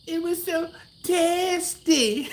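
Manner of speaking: high mock Trinidadian voice of the kid taster; Trinidadian accent imitation. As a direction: ham up a Trinidadian child's delight. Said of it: "I do a horrible Trinidadian accent".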